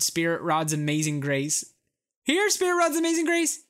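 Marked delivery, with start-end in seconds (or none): 2.2-3.6 high sing-song call to a dog. Retold or imitated. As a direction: call the dog home from across the park. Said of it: "Maybe someone actually does call".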